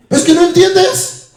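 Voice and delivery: high, slow mock whine; whiny complaint. Preacher mimics a believer complaining to God, high whiny voice. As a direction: whine it like a child who feels misunderstood.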